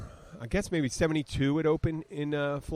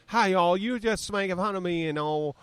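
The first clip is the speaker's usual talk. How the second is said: bad southern accent; 6 semitones higher than usual. Radio host imitating a prank caller, bad southern accent. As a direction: fake southern twang, pitched up. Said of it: "sounded like this".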